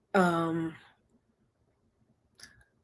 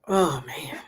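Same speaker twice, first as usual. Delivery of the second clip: whispering; 5 semitones lower than usual. Real, not acted. Sipping drink, settling in.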